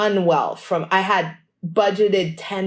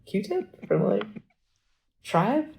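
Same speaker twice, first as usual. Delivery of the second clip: stunned; 6 dB quieter, much slower than usual. Retold or imitated. Shocked at the news.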